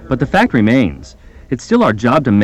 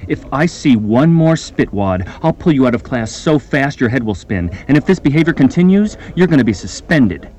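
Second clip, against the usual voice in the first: ordinary delivery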